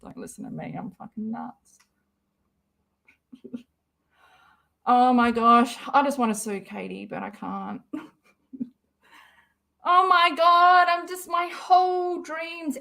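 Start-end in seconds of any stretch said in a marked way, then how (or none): 0.0-1.8 hurried whispered aside; whisper, brushing it off. Real, not acted. Self-deprecating aside. 9.9-12.8 slow, loud and higher cry of dismay; loud, drawn-out dismay; anguished outburst. Real, not acted. Upset over Chrisley news.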